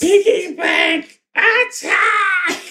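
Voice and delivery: high, drawn-out cartoon attack shout; battle cry. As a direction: yell it like a monster's special move. Calls out the monster's attack.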